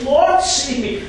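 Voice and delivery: loud, high cry of a drowning man; Peter's cry. Acted. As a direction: shout for rescue as the waves close in.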